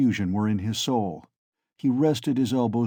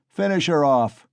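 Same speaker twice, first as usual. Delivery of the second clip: shouting; 4 semitones higher, 5 dB louder than usual. A narrator's reading. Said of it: "shouted Mikolka".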